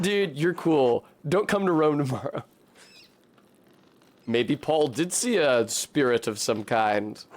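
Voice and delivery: normal talking voice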